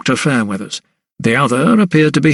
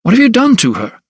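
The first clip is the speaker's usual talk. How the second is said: higher, alarmed demand; sudden outburst; 10 semitones higher, 3 dB louder than usual. A narrator's reading. Doctor confronting the asylum principal, alarmed angry outburst. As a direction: burst out at the man who locked her up. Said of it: "the doctor's sudden outburst".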